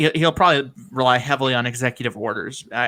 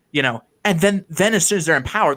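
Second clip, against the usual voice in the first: ordinary talk, nothing marked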